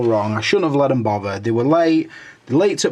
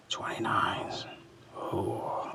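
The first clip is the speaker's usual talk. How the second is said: whisper; 3 semitones lower, 17 dB quieter, much slower than usual. Real, not acted. Muttering while scanning comments.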